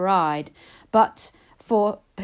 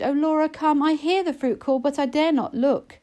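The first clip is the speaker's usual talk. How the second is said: put-on higher, pleading young sister's voice; Lizzie urging; 6 semitones higher than usual. A narrator's reading. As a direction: urge her sister home, anxious and higher. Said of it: "Till Lizzie urged".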